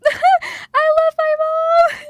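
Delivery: long, loud, high tearful wail; laugh-cry it out, stretching the words; drawn-out sobbing declaration; wailing affection. Real, not acted. Wails love for mother.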